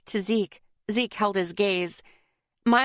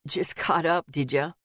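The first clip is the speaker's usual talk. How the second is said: muttered; 3 semitones lower than usual. A narrator's reading. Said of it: "Ethan muttered".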